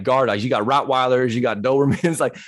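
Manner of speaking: normal talking voice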